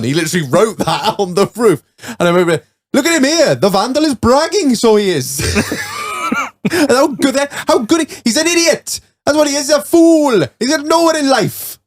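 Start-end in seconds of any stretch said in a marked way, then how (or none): 2.9-11.8 mock outraged adult. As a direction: ham up an indignant grown-up scolding. Mocking an adult ranting about vandal.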